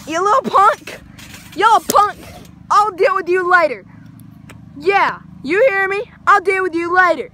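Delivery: hammed-up angry warning; mock threat. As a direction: threaten them like a cartoon tough guy. Acted threat to a punk.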